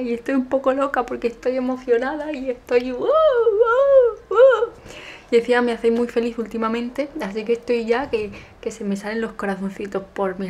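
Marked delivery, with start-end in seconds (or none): 2.9-4.7 high loud squeals of joy; happy shrieks; excited squeal. Real, not acted.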